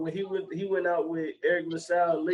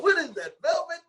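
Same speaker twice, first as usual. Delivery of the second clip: much higher, amazed ask; surprised question; 13 semitones higher than usual. Retold or imitated. Retelling the barbershop scene, high amazed question. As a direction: marvel at the coat. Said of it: "It was like".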